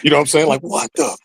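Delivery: high-pitched, hushed disbelief; retold flipping out. Rookie to himself after meeting his idol, flipping out. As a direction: replay a starstruck inner freak-out. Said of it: "I'm flipping out".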